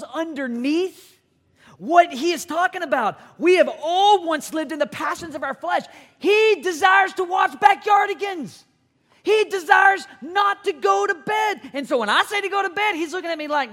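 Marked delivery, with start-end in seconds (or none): 3.4-11.7 loud, higher preacher's shout; preaching at full force. Acted. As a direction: drive the point home to the congregation.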